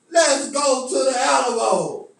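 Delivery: loud slow call to march on the Alamo; slow protest cry, high and loud; rallying shout. Retold or imitated.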